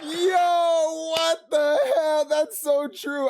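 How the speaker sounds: high, loud, drawn-out exclamation; slow, high outburst of delighted recognition; amused disbelief. Real, not acted. Reactor finding the joke so true, amused disbelief.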